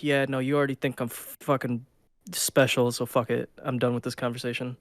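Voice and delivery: low, soft, grumbled dismissal; resigned brush-off. Retold or imitated. Tongue-tied speaker giving up on the listener, resigned grumble. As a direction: give up on the talk, quiet and low. Giving up after botched first words.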